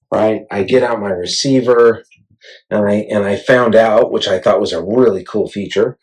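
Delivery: normal talking voice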